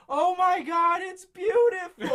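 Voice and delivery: high, loud, slow shriek of joy; hammed-up delight. Sitcom woman finally recovers the ring, shrieking with joy. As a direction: squeal it like a sitcom bride overwhelmed. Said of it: "she was like".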